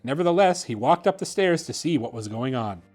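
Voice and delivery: plain reading voice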